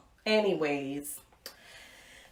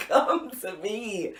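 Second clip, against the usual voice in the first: laughing come-on; 3 dB quieter, slower than usual. Retold or imitated. Sultry daydream, breaking into laughter.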